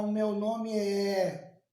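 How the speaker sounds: "O meu nome é" dragged out, É held long; slow, over-separated "O meu nome é"; unnatural separated vowels. Shown as a demonstration. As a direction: stretch "nome é" slowly, vowels kept apart. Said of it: "Você não fala".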